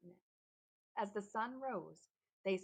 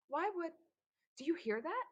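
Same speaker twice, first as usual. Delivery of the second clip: sudden alarm; 9 semitones higher, 6 dB louder than usual. A narrator's reading. Antbite breaks off, alarmed.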